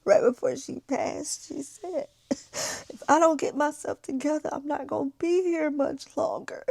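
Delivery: tearful, voice breaking; fighting back tears; crying. Real, not acted. Retelling friend's dying words, crying.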